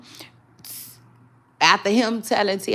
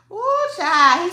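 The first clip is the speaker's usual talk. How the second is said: much higher, louder excited squeal; excited ooh; 13 semitones higher, 8 dB louder than usual. Real, not acted. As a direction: squeal it out in delight. Reacting to the diss.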